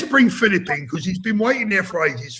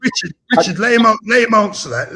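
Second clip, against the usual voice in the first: raised voice, cutting across the row; loud interruption; 4 semitones higher, 5 dB louder than usual. Real, not acted. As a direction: shout over them to take the floor. Host cuts in on a row.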